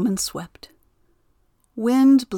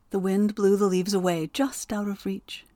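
ordinary delivery, nothing marked

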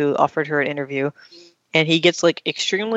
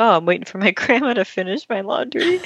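laughing; 7 semitones higher than usual. Retold or imitated. Joey's lame excuse, laughing.

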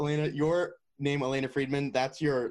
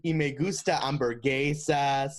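mock American-accented Spanish. Hammed-up American reading beginner Spanish.

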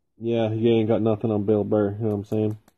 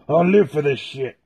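raised, louder put-on tough-guy voice, quick burst; riffing on Dom from Fast and the Furious, a shouted movie-hero impression; 4 semitones higher, 5 dB louder than usual. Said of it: "Like Dom from, like, Fast and the Furious".

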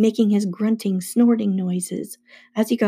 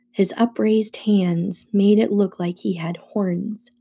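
plain reading voice

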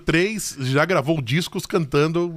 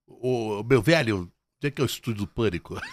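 low, quiet imitation of a guest; imitating a guest; 7 semitones lower, 5 dB quieter than usual. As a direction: mumble it like a lost guest. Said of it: "ele chegou lá".

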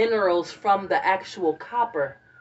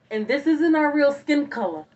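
excited; 8 semitones higher, 5 dB louder than usual. Retold or imitated. Dropping her big theory.

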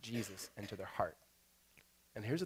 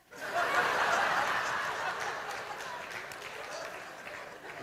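loud high laugh; 5 dB louder than usual. Real, not acted. Big laugh at his own joke.

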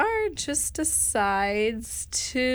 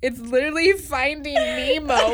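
incredulous; 5 semitones higher, 5 dB louder than usual. Retold or imitated. Mock-exasperated reply to sister.

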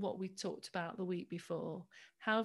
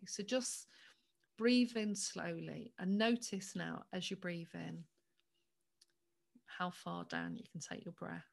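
guided meditation. Acted. Leads a breathing meditation.

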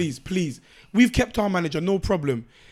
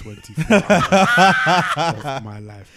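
lost voice, slow and croaky with a laugh; hoarse voice; 7 semitones lower, 6 dB louder, much slower than usual. Real, not acted. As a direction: rasp it out, voice nearly gone. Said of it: "My voice is gone".

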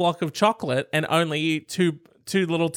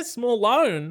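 pitched-up sneaky echo; mocking the sneaky line; high mock; 8 semitones higher than usual. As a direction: squeak it out high and sly. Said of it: "What was that sneaky little line he snuck in there?".

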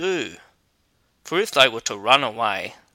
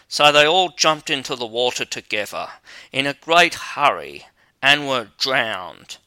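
ordinary delivery, nothing marked

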